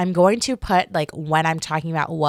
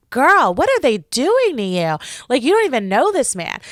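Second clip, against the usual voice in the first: higher, swooping mock disbelief; retold incredulous reaction; 5 semitones higher, 3 dB louder than usual. Viewer to a sobbing contestant, incredulous. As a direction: exclaim it as if pleading with her to get a grip.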